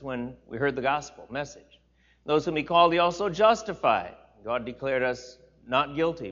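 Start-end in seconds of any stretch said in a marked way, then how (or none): 2.2-4.1 louder, raised preaching read; scripture reading. Acted. Pastor reading Jesus' words aloud to the church, raised and solemn. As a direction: proclaim the verses to the congregation. Reads Romans eight aloud.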